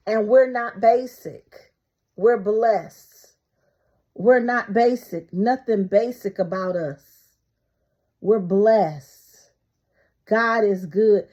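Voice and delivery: loud proclaiming declarations; preaching at full force. Real, not acted. Proclaiming to her live viewers they are blessed, loud preaching. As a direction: declare it to the congregation.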